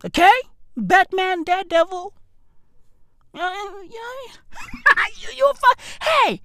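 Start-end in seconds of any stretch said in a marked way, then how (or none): 4.5-5.2 short high burst of laughter; yelping laugh. Real, not acted. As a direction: crack up. Laughing at own joke.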